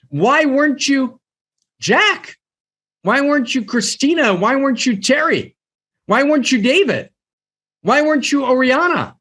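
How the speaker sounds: high, pressing question to each listener; heavenly question. Retold or imitated. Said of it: "And the question's gonna be".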